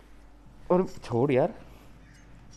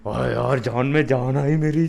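loud relief; 2 semitones higher, 7 dB louder than usual. Real, not acted. Relief after escaping zombies.

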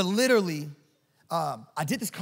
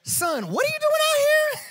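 much higher, louder mock cop voice through a laugh; cop's voice; 6 dB louder than usual. Preacher mimicking the cop at his car door, high and louder. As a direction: squeak out the startled cop's question. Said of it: "and he said".

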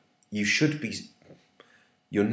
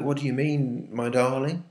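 sweetly reassuring, higher; 6 semitones higher than usual. Shown as a demonstration. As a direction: soothe him like a loving wife. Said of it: "in a reassuring way".

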